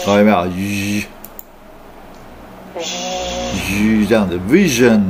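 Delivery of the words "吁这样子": "吁" drawn out very slowly as a buzzing voiced zh; slow zh demo. Shown as a demonstration. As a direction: stretch the buzz of "吁" out slowly. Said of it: "这个音很难念哦".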